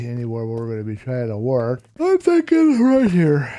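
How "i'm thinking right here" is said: a real yawn pulling the words high and slow; yawning while talking. Real, not acted. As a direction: let the yawn stretch "I'm thinking right here". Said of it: "Pardon me for yawning".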